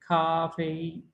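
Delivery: खा फेई held level and slow on both syllables; two first tones, slow. Shown as a demonstration. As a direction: drag out खा फेई flat and even. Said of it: "same ही रहेगी".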